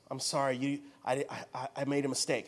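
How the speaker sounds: low, soft, halting admission; shaken confession. Retold or imitated. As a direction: imitate a winded driver owning up haltingly. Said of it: "And he's breathing heavy".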